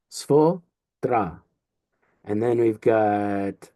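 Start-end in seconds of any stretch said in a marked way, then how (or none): 0.1-1.4 "Svodra" in two pieces, svo-dra; split before d. Shown as a demonstration. Teacher repeats the right split for students, "Svodra" as svo-dra. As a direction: pause in "Svodra" after svo, then dra. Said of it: "We're gonna say svodra".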